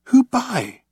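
high, urgent demand; urgent question. A narrator's reading. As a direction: press for the answer. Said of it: "asked Ford".

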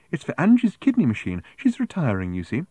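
high, prim explanation, easing down; prim raffle seller. A narrator's reading. Woman soliciting Fenny for Angie, prim.

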